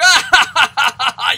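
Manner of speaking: loud, high staccato cackle; point-and-laugh guffaw, hammed up; mocking laugh. Man following an old growler down the street, loud mocking laughter. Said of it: "pointing and laughing".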